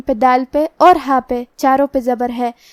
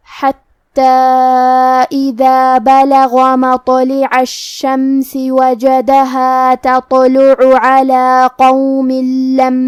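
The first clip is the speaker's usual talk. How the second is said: slow, louder chanted tajweed recitation; Quran recitation; 4 dB louder, much slower than usual. Acted. Teacher reciting the full verse as a model, slow chant. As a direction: recite the verse unhurried, each rule sounded.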